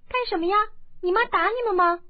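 put-on high, loud little girl asking; a young girl questioning the crying boys; girl's voice. A narrator's reading. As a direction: ask with childish bluntness. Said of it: "郭福道".

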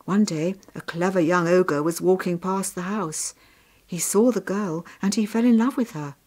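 ordinary delivery

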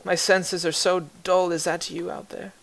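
high, soft, faint and failing voice; frail old woman. Acted. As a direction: call out weak and dull-sensed. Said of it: "you hear the voice call out once again".

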